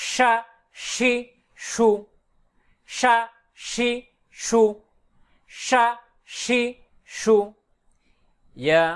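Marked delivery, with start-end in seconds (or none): 0.1-7.4 "সা শি সু" with a long sh spread through the mouth; spreading shin. Shown as a demonstration. Teacher demonstrates shin, sound spread inside the mouth. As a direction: let a full sh spread before each vowel in "সা শি সু". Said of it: "আওয়াজ মুখের ভিতর ছড়িয়ে দিতে হবে".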